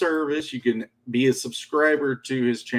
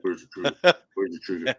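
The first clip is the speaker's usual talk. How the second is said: low British-accented mock interrogation; Daniel Craig; 5 semitones lower, faster than usual. Host mimics Daniel Craig as Batman, low British accent. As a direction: drop the pitch, put on British. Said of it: "The Daniel Craig, he'd try to talk with the accent".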